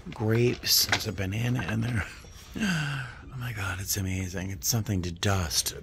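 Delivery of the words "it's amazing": ordinary talk, nothing marked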